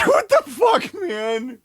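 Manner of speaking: high incredulous shout with a laugh; laughing disbelief. Real, not acted. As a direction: shout in amused shock.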